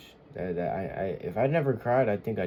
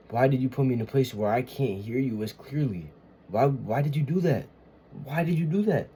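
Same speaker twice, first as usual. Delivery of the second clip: exasperated, rising pleading; anguished plea to God; 4 semitones higher, 4 dB louder than usual. Acted. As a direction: demand an answer from God, louder and higher.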